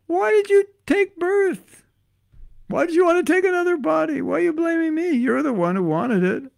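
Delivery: high-pitched, indignant comic Krishna; Krishna scolding back. Acted. Krishna answering a complaining devotee, high-pitched scolding. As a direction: fire back in a high, exasperated put-on voice. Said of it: "And Krishna says".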